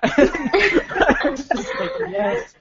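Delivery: squeaky laugh through the words. Real, not acted. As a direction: laugh while you say it. Laughing through retold reaction.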